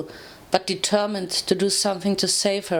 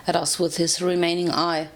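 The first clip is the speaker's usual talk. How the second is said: ordinary delivery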